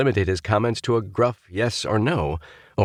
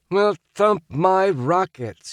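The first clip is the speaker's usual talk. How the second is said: slow, amazed exclamation; exclaimed; 13 semitones higher, slower than usual. A narrator's reading. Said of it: "he exclaimed".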